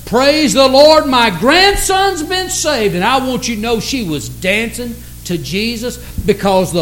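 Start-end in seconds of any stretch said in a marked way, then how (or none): 0.0-3.0 raised, drawn-out cry of praise; mildly shouted hallelujah, swelling as it goes; retold joyful shout. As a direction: imitate a grandmother's jubilant shout, higher in pitch. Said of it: "she began to shout".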